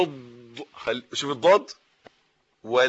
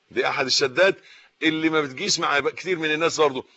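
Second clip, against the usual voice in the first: ordinary talk, nothing marked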